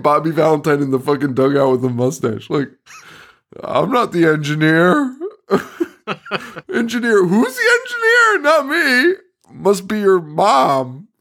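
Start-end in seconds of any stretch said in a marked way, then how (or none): 3.6-11.1 squeaky mock Pinhead. Voicing Pinhead's silly denial.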